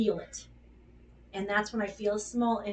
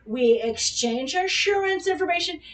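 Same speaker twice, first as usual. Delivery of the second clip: put-on higher and louder, spelling it out like to a child; mock instructing; 7 semitones higher, 8 dB louder than usual. Woman coaching her panicking boyfriend, high and loud. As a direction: talk him through it like a kid. Instructing grown man on roadside.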